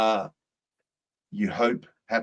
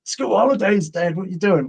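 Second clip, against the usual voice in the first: mock-whining complaint, pitched high; angry sons; 9 semitones higher, 5 dB louder than usual.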